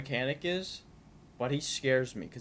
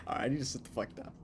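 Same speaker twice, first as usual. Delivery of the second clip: ordinary delivery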